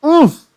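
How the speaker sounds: sharp high pained "Oof!". Real, not acted. Hearing the shirt was already bought, a pained oof. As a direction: let out a stung little oof.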